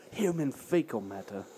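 low quiet imitation of his voice; imitating his deadpan. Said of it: "as he likes to say".

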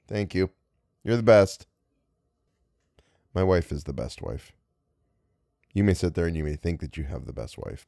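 normal talking voice

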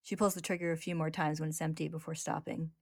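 ordinary delivery

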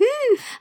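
sharp high intake of breath; startled gasp. Retold or imitated. Retelling her shock at the ad account, sharp gasp. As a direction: gasp in mock shock at the screen. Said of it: "And I went".

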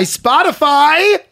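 drawn-out, high, loud cry of the brand; hammed-up enthusiasm. Host cheering the sponsor, hammed-up enthusiasm. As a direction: shout it slowly like a mock superfan.

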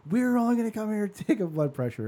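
high-pitched mock Filipino-accented mom. Mom refusing the hospital, high indignant Filipino accent. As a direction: protest in an exaggerated Filipino accent. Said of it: "in her crazy Filipino accent".

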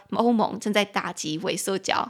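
ordinary delivery

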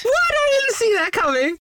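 hammed-up shock; mock surprise. Mock shock at obvious twist.

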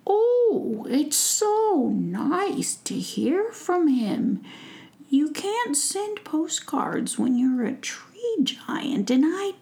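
high, slow delighted giant; gentle giant glad of news; happy tree giant. Acted.

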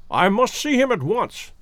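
a touch of eager haste, without shouting; urgent cry. A narrator's reading. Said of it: "cried the professor".

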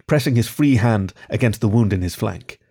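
plain reading voice

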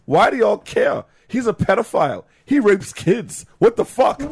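half-acted angry friend, slowed in places; retold outburst. Retold Dakari yelling about Jackson.

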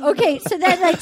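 normal talking voice

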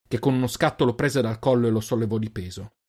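ordinary delivery, nothing marked

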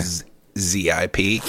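Z I P said as separate letters; spelled out. Shown as a demonstration. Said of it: "just spell out stuff like that".

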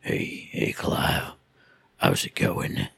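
very low rumbling voice; Sid's rumble. Retold or imitated. Host imitates deep-voiced Sid greeting Clive, very low rumble. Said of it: "He can rumble at me".

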